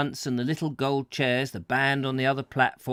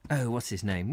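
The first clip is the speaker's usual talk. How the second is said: plain reading voice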